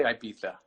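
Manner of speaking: Ibiza with a th for the z; Spanish pronunciation. Shown as a demonstration. Guest explains local names to host, Spanish Ibiza with th. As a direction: say Ibiza as "eye-BEE-tha". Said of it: "They throw the, the T H in there".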